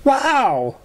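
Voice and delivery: high wow of wonder; awestruck. A narrator's reading. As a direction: gasp it admiringly.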